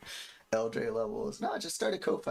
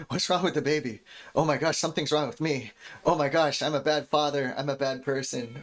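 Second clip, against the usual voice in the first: louder, slightly raised worried voice; hammed-up fretting, pushed louder; mock new-parent panic; 3 semitones higher, 6 dB louder than usual. Acts out first-time parent panicking.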